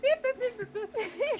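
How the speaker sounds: high-pitched beep-boop button noises; imitated console beeps. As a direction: make high little machine bleeps. Imitating console buttons being pressed.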